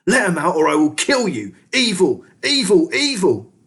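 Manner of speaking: high, loud, frantic rat screaming; rising furious screams of evil; screaming threat. A narrator's reading. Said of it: "screamed Ham and Pork".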